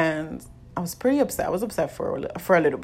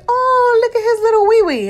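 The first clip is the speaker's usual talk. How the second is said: very high, loud gushing voice; mimicking the cheerful sonographer's high squeal; high excited sonographer; 10 dB louder than usual. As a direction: squeal it like a delighted nurse. Sonographer voiced in retold scene.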